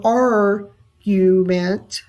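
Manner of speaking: "argument" slowly broken up, long stressed "ar"; stress on first syllable. Shown as a demonstration. Teacher breaks down the dactyl, "argument" slow with stressed "ar". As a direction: stretch "ar", then separate "gu-ment" in "argument". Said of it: "the R is stressed".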